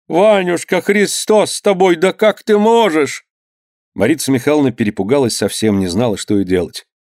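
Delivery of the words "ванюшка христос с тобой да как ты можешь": высокий старушечий голос, с испугом; ахни по-старушечьи, испуганно; испуганная старуха. A narrator's reading. Голос матери. (мать пугается слов сына, высоко)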